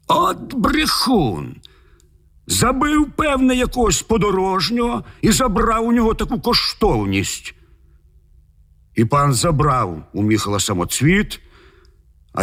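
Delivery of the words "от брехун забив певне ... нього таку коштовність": shrieking. A narrator's reading. The lord calls Michal a liar and killer, shrieking. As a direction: accuse in a high-pitched squawk. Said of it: "той заверещав".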